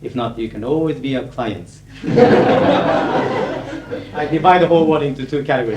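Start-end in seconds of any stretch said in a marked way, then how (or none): 1.9-4.1 high airy laugh, no voice; a breathy chuckle after the joke. Real, not acted. Joking that the audience can be clients, airy laugh.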